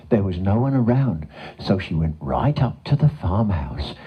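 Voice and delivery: ordinary delivery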